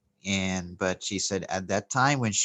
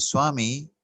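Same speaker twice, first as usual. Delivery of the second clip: more emphatic; 4 semitones higher, 3 dB louder than usual. Retold or imitated. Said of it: "So she put more emphasis and said".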